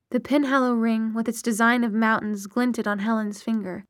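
ordinary delivery

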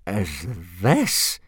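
lightly incredulous, broken off; appalled demand. A narrator's reading. Instructor staring at the boy's dragon, demanding. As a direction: finish the demand with a touch of disgust. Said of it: "demanded Gobber".

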